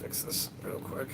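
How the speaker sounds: breathy unvoiced mutter; whispered aside. Real, not acted. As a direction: say it under your breath.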